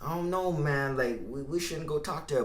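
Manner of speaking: lightly voiced nervous boy, hesitant; a hint of the timid old self talking him out of it; scared inner voice. Timid inner voice urging him not to approach the girl, hesitant. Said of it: "I hear his voice too".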